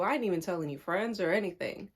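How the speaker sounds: normal talking voice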